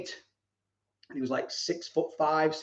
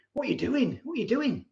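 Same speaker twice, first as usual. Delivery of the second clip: incredulous officer; 6 semitones higher, 3 dB quieter than usual. Retold or imitated. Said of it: "He went".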